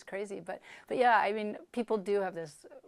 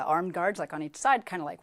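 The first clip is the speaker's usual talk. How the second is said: usual speaking voice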